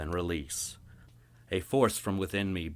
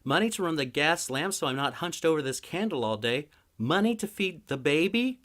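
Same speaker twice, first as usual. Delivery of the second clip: higher, sharp and fretful rant; fed-up wife; 11 semitones higher, 6 dB louder than usual. Acted. Wife ranting to her husband about money, sharp and fretful. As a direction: pile the worries on him.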